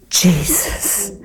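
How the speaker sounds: Jesus whispered with a lift at the end; hushed reverent whisper; whispered name. Real, not acted. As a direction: breathe the name. Urgent repeat to the audience.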